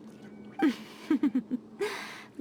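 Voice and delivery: breathy, light laugh rising at the end; a sly little chuckle. Acted. Teasing after the Shogun's reply.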